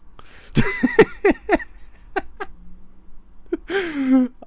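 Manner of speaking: long high cackling laugh; real helpless, high laughter; real laughing fit. Real, not acted. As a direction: lose it completely laughing. Cracks up at film reference.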